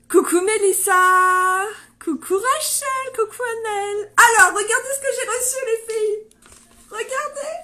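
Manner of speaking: loud, high, bursting with excitement; excited greeting. Real, not acted. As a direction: call out to the viewers, thrilled. Excited greetings to live viewers.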